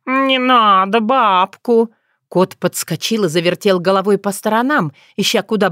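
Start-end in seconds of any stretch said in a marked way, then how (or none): 0.1-1.9 high, drawn-out cat plea; alarmed cat. Acted. Cat hearing that granny may come, alarmed and drawn-out. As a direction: wail it slowly in alarm.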